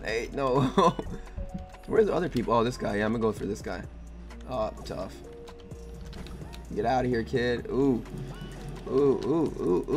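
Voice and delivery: ordinary talk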